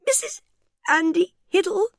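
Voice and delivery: very high squeaky gasp through giggles; helpless giggling gasp; gasping with laughter. Acted. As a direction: barely get the name out for laughing. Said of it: "she gasped".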